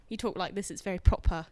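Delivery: low, proper English voice; proper British accent. Shown as a demonstration. Guest demonstrating on request, proper British accent. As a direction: talk very properly, like a Brit. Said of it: "Let's hear a British accent".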